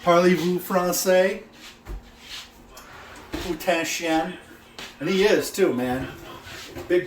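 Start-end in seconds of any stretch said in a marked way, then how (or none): 0.0-4.4 slow, raised-pitch French phrases; showing-off French. Pretend. Home cook bragging he can rival a TV chef, showy French. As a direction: draw the French out boastfully. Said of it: "I can speak French too".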